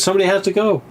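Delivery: high mock insistence, retold; a hint of a stubborn actor; imitating Jamie. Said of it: "He goes".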